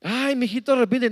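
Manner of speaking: put-on high, coaxing child voice; pleading child. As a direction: coax him gently to repent. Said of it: "mi mamá".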